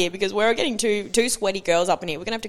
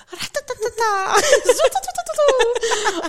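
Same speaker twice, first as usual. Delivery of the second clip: imitated car backfire; 14 semitones higher, 4 dB louder than usual.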